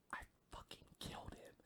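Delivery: leaning-in, voiceless whisper, quick; hushed, breathy reveal, acted out; mock whisper. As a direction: whisper it like a secret confession. [name] leans in and whispers.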